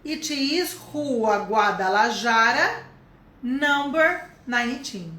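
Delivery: slow, clear address; slow model answer. Shown as a demonstration. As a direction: dictate the address slowly. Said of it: "vou falar do meu endereço".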